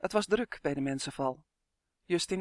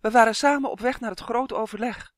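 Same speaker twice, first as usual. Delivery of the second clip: raised, higher and louder voice; calling out; 6 semitones higher, 7 dB louder than usual. A narrator's reading. Justin explaining himself to the fairies, urgent call. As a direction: announce it urgently. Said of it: "riep hij vlug".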